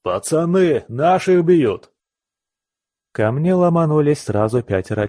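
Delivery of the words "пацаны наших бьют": higher, slow yell to the gang; rallying shout. Acted. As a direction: call your mates into the brawl. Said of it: "и кто-то крикнул". (призывный крик, выше и медленно)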